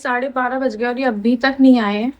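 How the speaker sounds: ordinary delivery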